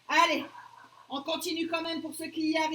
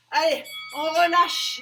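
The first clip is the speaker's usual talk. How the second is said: appel fort; 9 dB louder, slower than usual. Real, not acted. Coach calls end of set.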